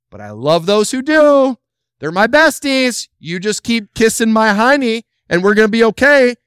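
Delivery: high, loud sarcastic voice; mock smug churchgoer, high and loud; mock selfish churchgoer. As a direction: ham up a smug self-centered member. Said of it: "how many in this room alone say".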